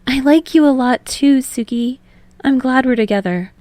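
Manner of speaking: sweet, happy confession; Hinata confession. Acted.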